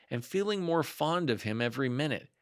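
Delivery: usual speaking voice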